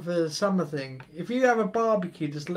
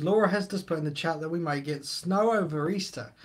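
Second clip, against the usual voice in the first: normal talking voice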